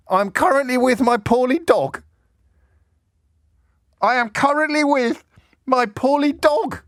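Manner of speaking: milk his poorly dog excuse; hammed-up self-pity. Host mocking an MP's excuse, high plaintive whine. Repeat, higher and slower.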